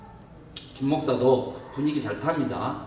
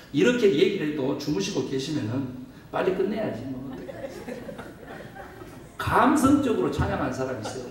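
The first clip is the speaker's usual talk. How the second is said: ordinary delivery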